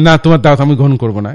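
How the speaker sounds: loud, fast blunt no; blunt refusal. Retold or imitated. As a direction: flatly turn down the invite, loud. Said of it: "এটা বলি না, এরকম বলি না".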